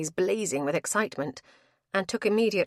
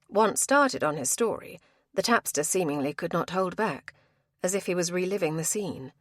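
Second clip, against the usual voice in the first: usual speaking voice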